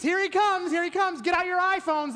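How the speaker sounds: high eager mock crowd call; lightly hammed-up onlooker; excited crowd. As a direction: townspeople lining the streets for the king. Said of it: "they would say".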